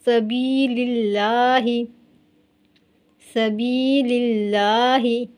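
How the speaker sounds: सबीलिल्लाही with a light, thin ल, drawn out slowly; slow tajweed recitation with thin lam. Shown as a demonstration. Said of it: "इस लाम को मोटा हरगिज़ नहीं करेंगे".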